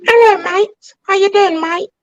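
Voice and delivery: high, hammed-up British mate voice; exaggerated British accent, pitched high; mock British accent. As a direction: play a cheeky Brit saying hello.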